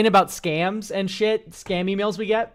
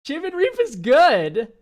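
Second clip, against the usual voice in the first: high, slow outraged cry; indignant protest; 8 semitones higher, 6 dB louder, slower than usual. Real, not acted. Streamer defends a card against the AI, indignant. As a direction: protest it loudly, genuinely offended.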